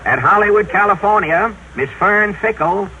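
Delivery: usual speaking voice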